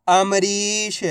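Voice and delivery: अमरीश drawn out, with long री; syllables blended slowly. Shown as a demonstration. Teacher reading for students to repeat, अमरीश drawn out. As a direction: stretch the ee inside अमरीश. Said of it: "उच्चारण करके इनको पढ़ रहा हूँ".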